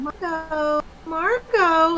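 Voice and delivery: put-on high, cooing call like a mother to her baby; sweet mommy voice. Mother cooing her baby's name, sweet high mommy voice. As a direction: coo the name sweetly, as to an infant. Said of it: "in a very sweet, very s-, like, mommy voice".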